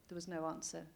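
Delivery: usual speaking voice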